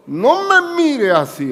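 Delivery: regaño agudo, fuerte y lento; la mamá que corta la mirada de rencor; madre regañando. Retold or imitated. (madre que regaña al hijo, aguda y lenta)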